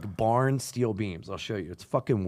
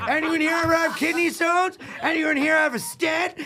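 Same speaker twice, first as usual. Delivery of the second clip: high, loud frantic yelling at the crowd; comic shouting questions at the crowd from the stage; manic shouting; 5 dB louder than usual. Retold or imitated. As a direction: shout it like a sweaty, desperate comic. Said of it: "I start to be like".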